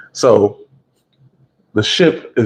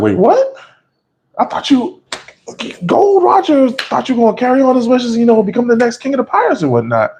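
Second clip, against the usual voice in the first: Buggy's squeaky disbelief; 14 semitones higher than usual. Retold or imitated. Buggy shocked at Shanks.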